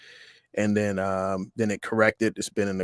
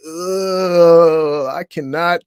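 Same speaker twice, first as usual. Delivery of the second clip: put-on high, loud childish disgust; a kid grossed out, high and loud ugh; 7 semitones higher, 9 dB louder, slower than usual. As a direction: recoil like a kid facing pig snouts. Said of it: "I was just like".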